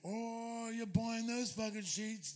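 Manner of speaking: lightly voiced driver teasing; driver's question. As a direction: drag it out in disbelief. Driver Tony asks.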